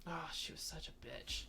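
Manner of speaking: hushed, breathy aside; whispering. Real, not acted. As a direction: whisper the insult like gossip. Confiding insult about boss.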